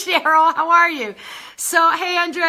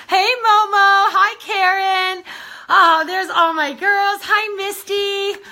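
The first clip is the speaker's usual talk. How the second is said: excited greetings; 6 semitones higher, 3 dB louder, slower than usual. Real, not acted. Greeting viewers by name.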